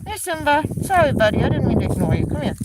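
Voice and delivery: loud, high coaxing pet voice; sweet-talking the cat. Real, not acted. Owner apologizing to her ignored cat, coaxing pet voice. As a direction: coax the cat over, sorry.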